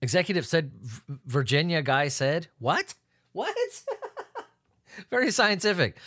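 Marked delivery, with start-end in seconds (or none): 2.6-3.5 puzzled double take; baffled at the line he read; confused what. Real, not acted. Reading a headline, confused what. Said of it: "I'm so fucking stupid".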